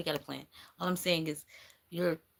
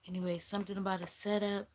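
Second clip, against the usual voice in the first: ordinary talk, nothing marked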